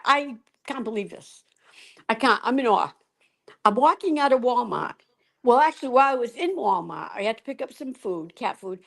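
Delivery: ordinary delivery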